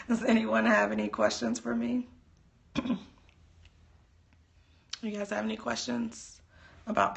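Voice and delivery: hoarse, fading voice; tired, strained voice; losing voice. Real, not acted. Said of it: "I'm losing my voice here".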